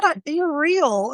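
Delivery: high, drawn-out imitation of disbelief; slow, high-pitched mock wonder; retold amazement. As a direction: repeat the customer's amazed words, amused. Amused retelling of customer's amazement.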